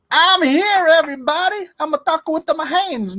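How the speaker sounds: high, hammed-up TV host greeting; mock host voice.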